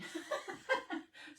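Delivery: breathy airy giggle at herself; soft laugh. Real, not acted. Amused at her bad singing, a soft breathy laugh.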